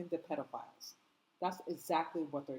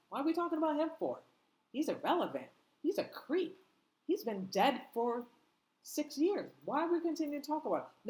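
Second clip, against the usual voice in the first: mocking officials; 8 semitones higher than usual.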